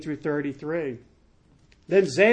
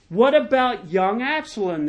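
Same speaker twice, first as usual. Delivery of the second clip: higher, insistent royal question; demanding; 6 semitones higher, 4 dB louder than usual. A narrator's reading. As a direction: press for an answer, pitch lifted. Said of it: "the king demanded".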